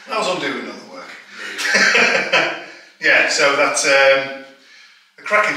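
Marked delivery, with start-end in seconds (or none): 1.4-2.6 breathy laugh. Real, not acted. Laughing after joke.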